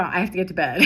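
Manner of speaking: usual speaking voice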